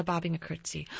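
usual speaking voice

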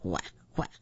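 deep low duck quacks. Acted. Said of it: "quacked the duck".

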